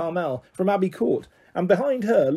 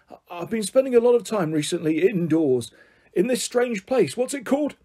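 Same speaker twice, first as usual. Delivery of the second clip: lightly voiced eccentric old man, raised pitch; rambling grandpa; 5 semitones higher than usual. Grandpa tells children why he went camping, rambling. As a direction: ramble on earnestly about the outdoors. Grandpa character explains himself.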